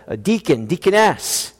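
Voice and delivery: ordinary talk, nothing marked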